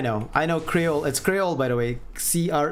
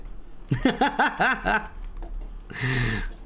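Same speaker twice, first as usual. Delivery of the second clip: helpless chuckling laugh; 4 semitones higher than usual. Real, not acted. Streamer laughing at their own bad accent, laughing. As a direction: laugh it off, breathless at the end.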